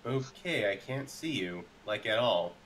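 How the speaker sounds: ordinary talk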